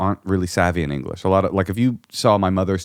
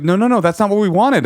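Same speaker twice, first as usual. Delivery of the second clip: much higher and louder, alarmed no; horrified protest; 12 semitones higher, 5 dB louder than usual. Retold or imitated. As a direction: cry out no in a panic. Callers protesting again.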